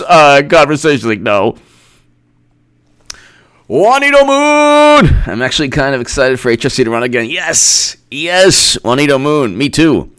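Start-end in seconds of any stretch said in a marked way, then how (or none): 3.7-5.2 high, slow sung-out name; drawn-out high shout-out; calling out. Real, not acted. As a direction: call the name out long and high.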